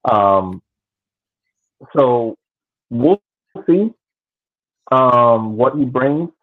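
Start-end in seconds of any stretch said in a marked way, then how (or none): none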